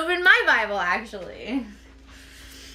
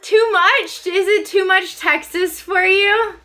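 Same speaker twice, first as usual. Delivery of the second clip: teasing; 5 dB louder, slower than usual. Real, not acted. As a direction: tease the viewer. Teasing chat about Texas song.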